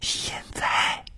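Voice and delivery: breathy urgent whisper; hushed urgency. A narrator's reading. Maid answering her mistress when it happened, urgent whisper. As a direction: whisper it with dread.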